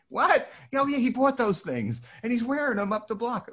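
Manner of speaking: breathless fan chatter; excited gossip. Retold or imitated. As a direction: gush the news like a starstruck kid.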